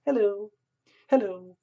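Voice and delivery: high, beaming sing-song hello; smiley greeting. Retold or imitated. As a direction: greet them with a big fake smile. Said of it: "coming at you with a complete smiley face".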